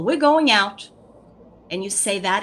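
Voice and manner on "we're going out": higher, louder sing-song announcement; announcing a routine. Shown as a demonstration. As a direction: call it out cheerfully to the child. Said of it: "For example".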